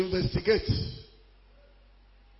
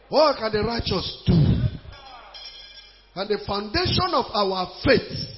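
usual speaking voice

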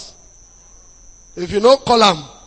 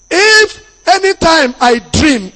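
higher, slow, stressed opening; emphatic preaching; 8 semitones higher, 5 dB louder, slower than usual. Acted. Pastor stressing a dream warning, emphatic and slow. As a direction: lean into each word.

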